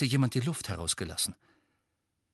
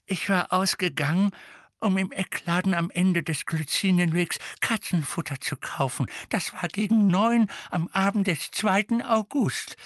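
babbling; 8 semitones higher, 3 dB louder than usual. A narrator's reading. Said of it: "plapperte Misses Figg sogleich los".

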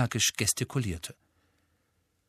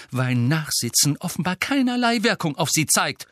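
higher, cutting retort; biting; 7 semitones higher, 4 dB louder than usual. Acted. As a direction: slap the protest down tartly. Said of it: "sagte Professor McGonagall bissig".

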